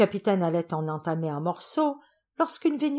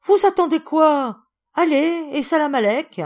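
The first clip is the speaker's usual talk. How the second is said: much higher and louder, hustling them out; shooing shout; 9 semitones higher, 9 dB louder than usual. Acted. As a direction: shoo them off with a big cheerful yell.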